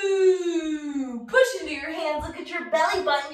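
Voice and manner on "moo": long moo gliding downwards, loud; cow moo. Pretend. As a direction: try a drawn-out cow moo. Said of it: "My cow sounds a little funny today".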